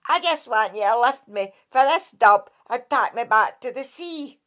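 the Dracai begging Kate to carry him to sea; exasperated water fairy. Acted. Lightly voiced impatient sprite.

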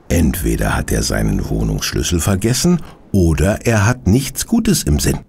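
ordinary delivery

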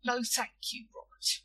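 measured, careful politeness; mildly formal refusal; polite. A narrator's reading. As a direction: decline with careful manners. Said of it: "said William politely".

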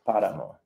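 páramo with the stress on PÁ, falling at the end; Spanish stress. Shown as a demonstration. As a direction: repeat páramo with the first syllable PÁ stressed. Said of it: "it's the emphasis on the first syllable".

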